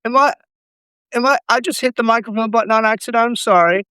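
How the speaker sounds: usual speaking voice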